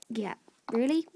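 whispered doubt; mock-doubting whisper; sarcastic whisper. Real, not acted. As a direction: breathe it out in disbelief.

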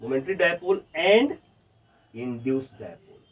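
very slow, word by word for students to write; slow dictation. Shown as a demonstration. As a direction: dictate each word and wait for the pens. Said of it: "अच्छे से लिख दो यहाँ पे". (बच्चों को धीरे-धीरे लिखवाते हुए)

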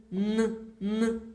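humming н, н on a long nasal tone; drawn-out н, н for children; sustained n sound. Shown as a demonstration. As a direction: hold the н like a hum. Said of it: "Вимовляємо н".

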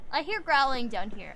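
ordinary talk, nothing marked

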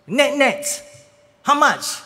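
high, loud eager question; eager resident. Retold or imitated. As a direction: ask it high like an anxious auntie. Resident asks final price.

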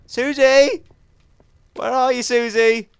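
high, loud call across the distance. Real, not acted. Gamer calls loudly for his missing pet Susie.

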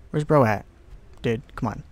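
ordinary talk, nothing marked

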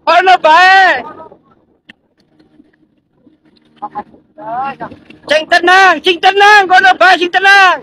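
loud, high shouts of calm down; urgent shout. Real, not acted. Rescuer shouting to a panicked survivor, loud and high. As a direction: shout over the waves to steady them.

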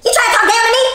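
high, loud indignant question; outraged challenge. Acted. Wife to husband she thinks is condescending, outraged. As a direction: flare up at the slight. Acted quarrel, she feels belittled.

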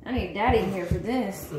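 normal talking voice

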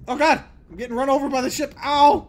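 fast, high panicked cries; panicked yelling. Real, not acted. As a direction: yelp as if being hit. Getting run over by ship.

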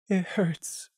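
lost and confused, barely holding on; blank stutter. Acted. Lemon stuttering blankly through tears to Prey.

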